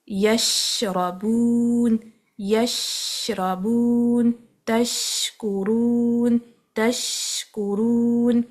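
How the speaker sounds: یشربون and تشکرون very slow with long sh; slow words with held sheen; spread sheen. Shown as a demonstration. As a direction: let the sheen spread fully. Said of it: "پورا پھیلتی ہے منہ میں".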